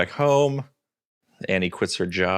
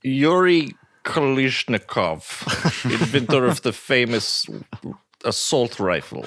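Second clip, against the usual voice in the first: slightly higher hammed-up Russian accent; mock Russian accent; 3 semitones higher than usual. Podcaster riffs on the oligarch pickup line in mock Russian accent. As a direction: boast like a cartoon Russian heir. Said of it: "a Russian accent with it?".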